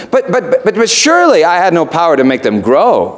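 high, stammering protest; flustered Mister Vane. Acted. Preacher voicing Mister Vane stammering back at the raven.

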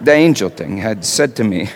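usual speaking voice

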